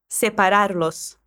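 Separarlos said slowly for repeating; slow and clear. Shown as a demonstration. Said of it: "esse los no final do verbo".

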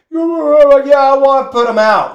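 high, loud blubbering wail; hammed-up sobbing plea; mock crying. Smoker begging to put cigarettes out, mock crying. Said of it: "crying and stuff".

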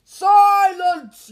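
huge high yell. A narrator's reading. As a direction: yell it to shut him up. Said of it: "yelled Uncle Vernon".

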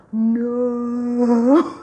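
high, loud, drawn-out slow-motion no. Retold or imitated. Norma realizing her mistake, slow-motion no. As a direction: stretch the no like a movie in slow motion. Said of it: "that "no" that you say in slow motion".

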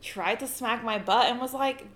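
ordinary delivery

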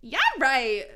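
loud, sudden exclamation; exclaimed. Acted. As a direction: throw it out loudly. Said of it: "she exclaimed".

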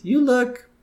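sweet, high and prim; Marge's voice. Retold or imitated. Marge complimenting Ruth, high prim housewife voice. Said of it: "she tells Ruth".

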